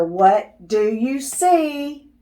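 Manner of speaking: ordinary delivery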